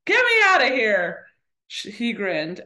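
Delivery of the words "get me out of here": loud, high-pitched urgent order; snapped demand. A narrator's reading. Persephone to Hermes in the book, snapping loudly. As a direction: snap it out loudly as the character. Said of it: "Persephone met Hermes' gaze and snapped".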